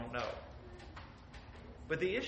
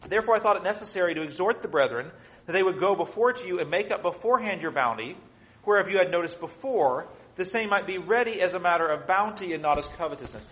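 louder, declaiming the Bible verses; scripture reading; 6 dB louder than usual. Acted. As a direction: read the verse like it's from the pulpit. Reads scripture aloud.

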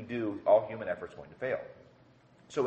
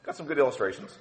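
unimpressed; 6 semitones higher, faster than usual. Retold or imitated. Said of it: "and I was like".

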